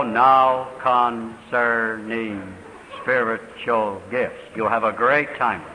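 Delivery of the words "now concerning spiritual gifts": very slow, dragged-out words; slow-motion tape playback. Shown as a demonstration. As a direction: drawl it like a tape played at half speed. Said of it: "play it slowly ... And it'll go".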